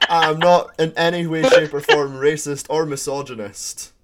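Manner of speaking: loud high mock outrage; loud protest. Real, not acted. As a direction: shout a jokey protest, slowing down.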